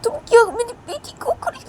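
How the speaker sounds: high, loud furious shout; frantic mother yelling, near tears; angry mother. Acted. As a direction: scream at her to let go. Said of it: "बोलती है".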